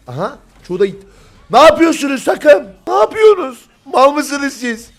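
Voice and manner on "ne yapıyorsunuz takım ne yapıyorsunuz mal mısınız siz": high, loud outraged scolding; exasperated yelling. Real, not acted. Caster scolds his team for a bad play, exasperated yelling. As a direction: shriek at them in exasperation.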